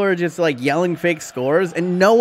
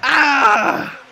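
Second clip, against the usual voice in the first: high, loud groan at the miss; frustrated cry; 8 semitones higher, 4 dB louder, much slower than usual. Real, not acted. Streamer groaning at Lukaku's miss, frustrated shout. Reacting to a missed chance.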